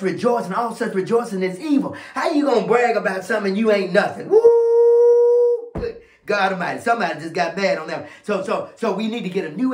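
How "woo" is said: very high, loud, long held woo; piercingly high sustained pulpit cry; high preacher's whoop. Real, not acted. Preacher whoops after hard point.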